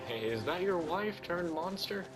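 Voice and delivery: usual speaking voice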